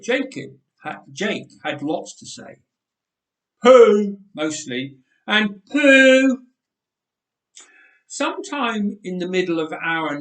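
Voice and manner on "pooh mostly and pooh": put-on loud, high baby word; baby babble. A narrator's reading. Jake the toddler babbling, loud and high. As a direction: squeal it like a toddler.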